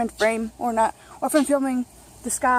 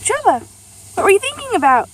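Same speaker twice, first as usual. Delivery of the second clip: calling out; 8 semitones higher than usual. Real, not acted. Calling to Trevor across room.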